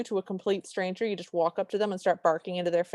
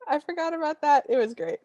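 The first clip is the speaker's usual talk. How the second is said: laughing; 8 semitones higher than usual. Real, not acted.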